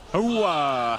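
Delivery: loudest military cheer, high and loud; loud gung-ho soldier. Acted. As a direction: give it everything like a drill squad. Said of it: "Loudest one".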